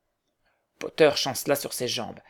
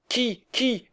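high-pitched, urgent asking; a crowd straining to see; eager question; 10 semitones higher than usual. A narrator's reading. Onlookers asking who is coming, urgent and high.

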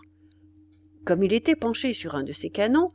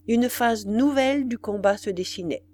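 plain reading voice

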